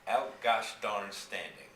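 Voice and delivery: cowboy drawl. Acted. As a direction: stretch it out like a Texas cowboy. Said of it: "said James".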